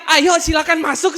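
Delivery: high, very loud, laughing call; comic triumphant holler through laughter; gleeful shouted invitation. Retold or imitated. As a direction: shout them in with mock welcome.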